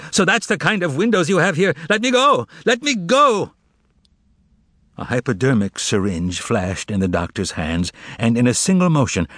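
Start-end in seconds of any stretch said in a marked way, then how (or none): 0.1-3.5 rising to a high, frantic shriek; screaming. Acted. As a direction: fight them off with your voice. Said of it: "and screamed".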